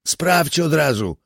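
high, eager command; can't hide the thrill as he gives the order; excited order. A narrator's reading. Commander tells Swieta to test it now, excited. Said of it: "nie kryjąc ekscytacji".

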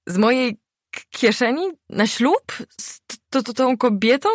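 high-pitched stuttering, tripping over words; stammering. A narrator's reading. The husband hears he paid for the wedding, stammering. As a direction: stutter it out in a panic. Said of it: "Zaczął się jąkać".

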